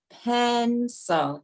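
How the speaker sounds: "pencil" with a long, held PEN; strong first syllable. Shown as a demonstration. Teacher leading class reading, first syllable of "pencil" held. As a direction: hold PEN, then drop on the end of "pencil". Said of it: "stand with all the strong syllables".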